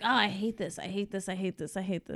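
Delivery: soft repeated grumble, sinking away; muttered dismay. Retold or imitated. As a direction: grumble it under your breath. Repeats own muttered dismay while watching.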